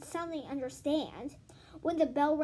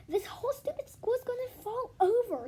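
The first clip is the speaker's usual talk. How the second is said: high, frightened inner voice; panicked thought; 9 semitones higher than usual. A narrator's reading. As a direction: panic rising as the stairs shake. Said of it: "he thought".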